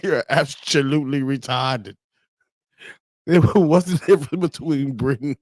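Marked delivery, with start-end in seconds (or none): 0.0-2.0 put-on British accent. Streamer reading another meme line, mock British accent. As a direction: exaggerate the British vowels. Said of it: "People be like".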